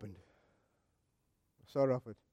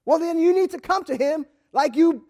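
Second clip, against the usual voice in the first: normal talking voice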